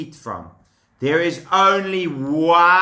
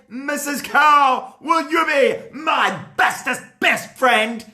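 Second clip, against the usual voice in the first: eager plea; 7 semitones higher than usual. Acted. Adam asks cow, shouting.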